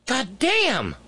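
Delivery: high-pitched exasperated curse; outraged outburst. Real, not acted. Host reacting to the governor's remark, outraged. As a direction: let the curse burst out high. Outburst over child's death.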